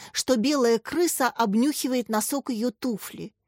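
ordinary delivery, nothing marked

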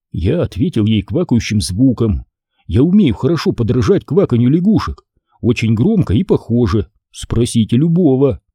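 ordinary delivery

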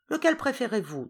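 lightly voiced old woman offering; hostess asking. A narrator's reading. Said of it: "demanda l'hôtesse".

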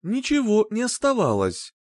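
usual speaking voice

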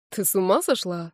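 plain reading voice

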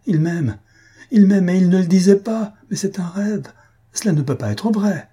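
high, swelling joyful outcry; rapturous enthusiasm. A narrator's reading. Faustine cannot believe she is loved, rapturous and exclaiming. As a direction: marvel aloud, transported with delight. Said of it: "s'écria Faustine avec un transport d'enthousiasme".

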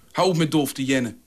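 plain reading voice

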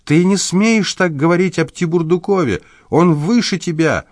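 high wailing protest; wailing outburst. A narrator's reading. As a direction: suddenly wail in his defence. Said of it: "вдруг заголосила".

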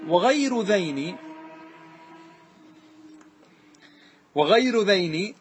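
slow, drawn-out وغير ذين; verse recitation. Shown as a demonstration. Teacher recalling verse, slow chant. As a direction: chant the verse words slowly. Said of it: "recites verse".

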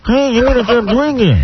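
high puppet-like voice; squeaky ventriloquist dummy voice; Senor Wences voice. Retold or imitated. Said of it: "he didn't talk like Senior Wences".

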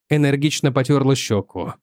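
ordinary delivery, nothing marked

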